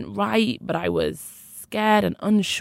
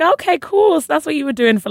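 high, loud mock-breezy sarcasm; sarcastic okay cool; 9 semitones higher, 8 dB louder than usual. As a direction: toss it off with bitter fake cheer. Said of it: "And I was like".

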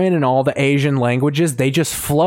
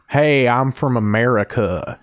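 slow hillbilly-tinged American drawl; fake American accent; 2 semitones lower than usual. Mocking how foreigners play Americans, slow hillbilly American accent. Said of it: "when they do an American accent".

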